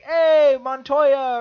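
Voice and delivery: loud, high, hearty hail; calling out. Retold or imitated. Quoting a poll worker greeting someone he knows, calling out. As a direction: imitate hailing a buddy across the room.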